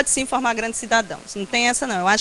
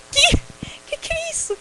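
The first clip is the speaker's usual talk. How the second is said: astonished. Retold or imitated. Winner hearing of an unexpected UNICEF prize, astonished. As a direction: re-enact being stunned, pitch shooting right up. Retold shock at surprise award.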